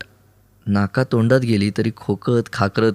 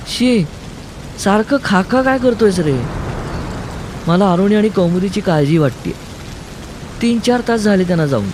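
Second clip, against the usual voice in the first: much higher voice of a fretting woman; Nisha's scolding worry; 9 semitones higher than usual. Acted. Nisha frets to Siddharth about the missing pair, worried scolding. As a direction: chide him, high-pitched and worried. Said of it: "असं निशा म्हणते".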